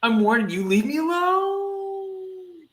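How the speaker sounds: rising, pained defiance; strained resistance. Acted. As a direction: push back against the probe. Resisting the mind probe.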